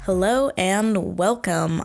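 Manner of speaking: ordinary talk, nothing marked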